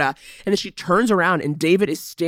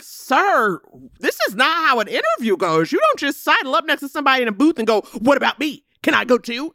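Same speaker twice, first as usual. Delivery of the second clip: put-on higher, indignant scolding of a rude boy; mock stern scolding; 7 semitones higher than usual. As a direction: play the offended adult telling him off. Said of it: "It was like".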